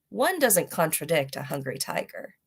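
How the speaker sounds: plain reading voice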